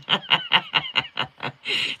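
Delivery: long, high run of giggles; giggling laugh. Real, not acted. Laughing at brother-in-law mocking her catchphrase, giggling laugh. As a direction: giggle away in a high, rapid string.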